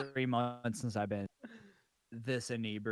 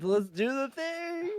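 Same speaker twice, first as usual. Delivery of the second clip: high, slow, goofy hype voice; hammed-up high voice; slower than usual. Drunk friend answering the hype in a silly high voice.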